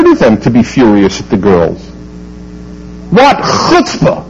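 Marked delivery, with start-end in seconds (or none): 3.2-4.2 much higher, slow and scandalised; indignant exclamation. Real, not acted. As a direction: spit out the outrage at the school. Indignant outburst at the school.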